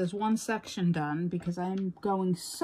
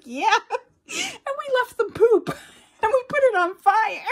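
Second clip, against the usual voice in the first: high, louder, laughing through it; grandfather, laughing; 13 semitones higher, 6 dB louder than usual. Retold or imitated. Grandfather bragging about the prank to kids, laughing. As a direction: tell the prank while cracking up. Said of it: "And the grandfather's like".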